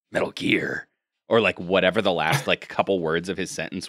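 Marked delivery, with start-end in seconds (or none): 0.1-0.9 low gruff repeating soldier; lightly voiced gruff Snake. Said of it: "Snake will respond and go".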